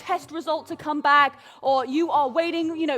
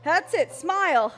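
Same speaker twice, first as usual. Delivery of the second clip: ordinary talk, nothing marked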